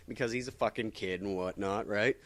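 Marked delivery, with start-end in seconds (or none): none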